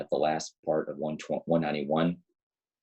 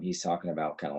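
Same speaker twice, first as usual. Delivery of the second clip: normal talking voice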